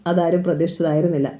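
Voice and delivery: ordinary delivery, nothing marked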